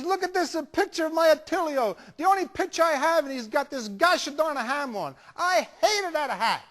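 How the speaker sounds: loud, high, wailing Italian grandma; Italian grandmother accent. Retold or imitated. Grandmother shows her husband's picture, outraged Italian accent. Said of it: "nice little old Italian lady".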